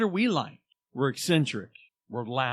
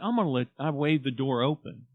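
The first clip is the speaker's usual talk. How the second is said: ordinary talk, nothing marked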